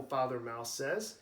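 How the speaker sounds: plain reading voice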